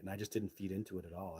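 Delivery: ordinary talk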